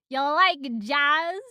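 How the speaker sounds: slow, loud, rising line; Jerry Seinfeld bee. Shown as a demonstration. Reader imitates the film bee, slow and loud. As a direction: drawl it as a loud question. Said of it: "Jerry Seinfeld B".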